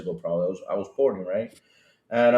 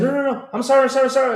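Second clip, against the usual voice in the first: mock panicked apology; 10 semitones higher, 4 dB louder, much faster than usual. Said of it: "So the guy said".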